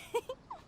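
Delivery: a short bright giggle; light giggle. Acted. Yoimiya to the travelers after saying everyone's been kind, quick giggle. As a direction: laugh it off lightly. Laughs after sharing thanks.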